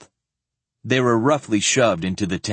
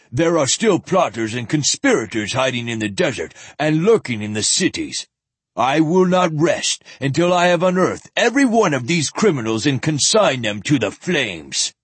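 zealot ranting; 5 semitones higher, 3 dB louder than usual. A narrator's reading. Zealot ranting about heretics.

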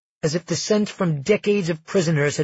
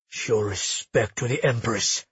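low, softer menacing growl; snarling guard; 5 semitones lower, 4 dB quieter than usual. Acted. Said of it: "Carlos snarled".

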